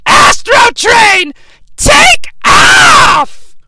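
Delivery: high, full-blast drawn-out yell; hammed-up battle cry, stretched out; mock command shout. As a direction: bellow it like a cartoon launch order. Mimics calling out a cartoon command.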